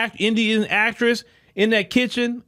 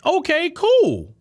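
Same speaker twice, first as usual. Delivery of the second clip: mock approval; 9 semitones higher, much slower than usual. Mock approval after hypothetical.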